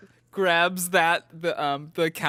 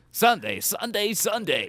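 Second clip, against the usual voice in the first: announcer voice; 5 semitones higher, slower than usual. Pretend. Monster truck ad parody.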